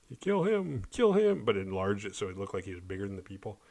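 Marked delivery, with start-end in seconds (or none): 0.1-1.5 put-on higher, playful kid voice; mock puppet play. Kids making a paper bunny attack, higher playful voice. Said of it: "we're just like".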